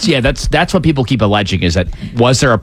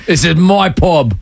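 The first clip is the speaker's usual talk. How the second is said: tough pub bloke; 6 semitones higher, slower than usual. Acted. Voicing the bruiser.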